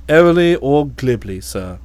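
airily or glibly in a low, calm valet voice; the valet offering the word, low and composed; Jeeves the valet. Acted.

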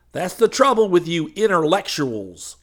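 ordinary delivery

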